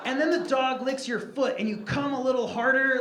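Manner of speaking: ordinary talk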